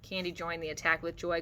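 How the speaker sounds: plain reading voice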